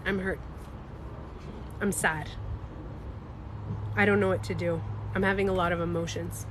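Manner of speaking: understated sadness; hurt and sad. Acted. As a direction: simply own the feeling, softly, with long pauses. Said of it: "Do you know what she could have said".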